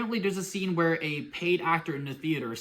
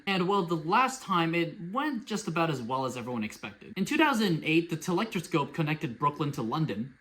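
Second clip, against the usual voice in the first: normal talking voice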